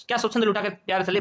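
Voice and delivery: usual speaking voice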